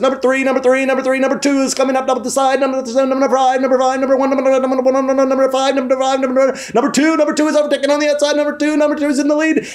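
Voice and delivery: rapid-fire monotone race commentary, very high; race calling. Retold or imitated. Race caller as horses come around, high breakneck chant. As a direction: gabble the runners on one high note.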